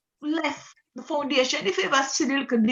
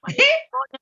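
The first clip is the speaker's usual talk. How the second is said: laugh; 14 semitones higher than usual. Real, not acted. Amused reaction to brethren story.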